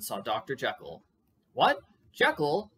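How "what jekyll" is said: higher, louder exclamation of recognition; surprised cry. A narrator's reading. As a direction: call out to an acquaintance unexpectedly spotted. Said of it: "he cried".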